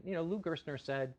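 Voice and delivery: ordinary delivery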